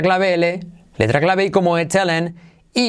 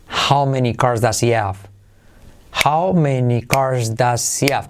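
slow, linked model sentence; repeat slowly; 3 semitones lower, slower than usual. Shown as a demonstration. Teacher drills the sentence slowly for learners. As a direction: say it slowly for students to copy.

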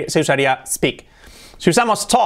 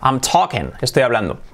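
normal talking voice

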